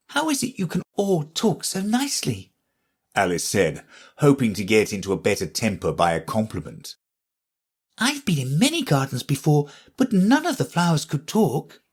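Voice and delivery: put-on high, light girl's voice; young Alice. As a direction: voice Alice as a curious young girl.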